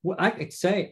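ordinary talk, nothing marked

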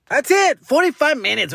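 high-pitched playful indignation; mock protest. Host telling listeners the episode is over, mock protest.

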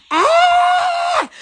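sudden high scream of alarm; startled scream. Real, not acted. Vlogger startled by a fall, high shriek. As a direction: shriek as something drops.

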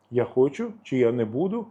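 normal talking voice

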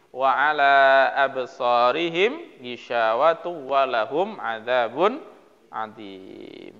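measured, chanted Quran recitation. Acted. Teacher reciting the verse for the class in a slow chant.

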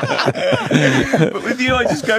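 high, laughing voice; real laughter spilling into the words. Real, not acted. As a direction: talk through helpless laughter. Laughing at his own tease.

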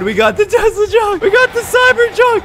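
very high, giddy squeal; jubilant celebration. Real, not acted. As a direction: crow in a high voice over the prize. Celebrates stealing the truck.

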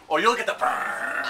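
slow mock threat.

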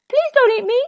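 high, loud plea; frightened cry. A narrator's reading. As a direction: beg for your life, pitched right up. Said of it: "cried Jack".

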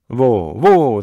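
loud, drawn-out cry of alarm. A narrator's reading. Driver calls to bolting horses, crying in alarm. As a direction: call out loudly to halt runaways. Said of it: "the driver cried in alarm".

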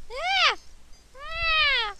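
two very high-pitched little screams; high squeals. Real, not acted. Player attacked by a game enemy, high squeals.

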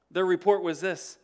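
ordinary talk, nothing marked

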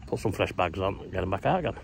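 normal talking voice

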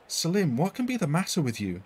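calling out in worried alarm; a touch of a fretful cry; mild cry. A narrator's reading. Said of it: "she cried".

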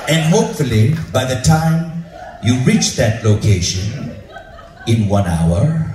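imitating a deliberate director, pausing for suspense; drawn-out, measured pronouncement; very slow, calm. Director tells Bob they will be friends, very slowly. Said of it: "this director was speaking was so slow".

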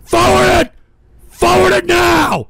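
high, loud command; frantic barking order, each one insistent; urgent shouted order. Real, not acted. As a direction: yell to cut it off at once. Host orders clip skipped.

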